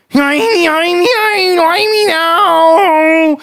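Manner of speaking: high, loud yell, last word drawn out; Chalk Windar and Chalk Lorthamar yelling. Acted. Game master voices two chalk figures crying out as they crack, high yell. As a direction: yell it out high, stretching the ending. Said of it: "both simultaneously yell out".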